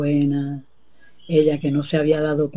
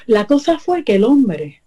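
plain reading voice

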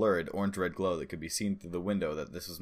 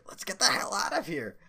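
put-on high, fearful old woman's voice; an old lady urging everyone out; 13 semitones higher than usual. A narrator's reading. Old woman urging neighbors to flee, high and scared.

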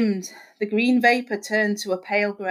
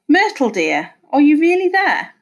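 high, loud rise on the question; incredulous hope; 6 semitones higher, 6 dB louder than usual. A narrator's reading. Husband calls to his dead wife, astonished. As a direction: call out in wondering disbelief.

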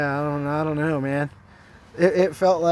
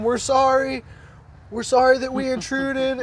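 high-pitched retold plea; acted-out pleading to be spared; begging apology; 8 semitones higher than usual. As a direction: call out a sorry, begging for mercy. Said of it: "we're, like, begging".

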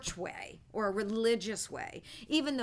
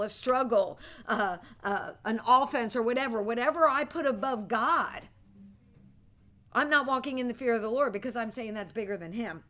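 ordinary talk

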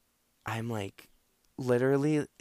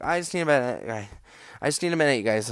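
higher, louder acted plea for a moment; retold urgent begging, pitched up and louder; panicked plea; 3 semitones higher, 5 dB louder, faster than usual. Faint storyteller begging the others for a minute, panicked.

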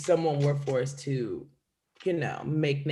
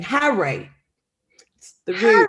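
fake British accent; 9 semitones higher, 6 dB louder than usual. Shown as a demonstration. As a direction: say "Harry" like a mock Brit. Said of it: "British accent is based off of saying".

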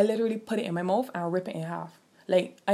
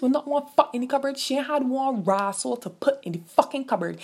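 raised, higher pitched indignation; angry rant; 6 semitones higher, 3 dB louder than usual. Real, not acted. Venting to viewers about the empty cupboard, angry and raised. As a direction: really let the anger rise. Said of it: "You see how angry I get?".

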